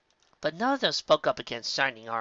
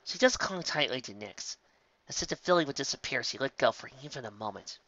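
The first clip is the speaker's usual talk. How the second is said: ordinary delivery, nothing marked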